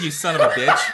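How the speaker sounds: mock curse through laughter; laughing insult. Real, not acted. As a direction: cuss him out while cracking up. Caught being pranked by chat.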